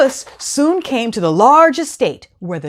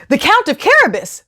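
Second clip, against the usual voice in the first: high answering voices; woodsmen answering; 9 semitones higher than usual. Acted. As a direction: answer up to the king.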